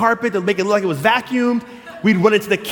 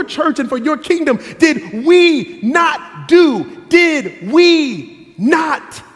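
pleading believers; 3 semitones higher than usual. Acted. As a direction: beg the Lord, growing desperate. Rejected believers pleading with Lord.

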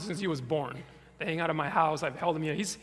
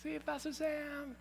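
calling out; 9 semitones higher, 4 dB quieter than usual. Retold or imitated. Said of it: "I yelled".

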